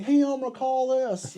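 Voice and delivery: high, drawn-out mock call to a coworker; imitating a doctor calling out. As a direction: sing out the order high and slow. Doctor calling out orders, retold.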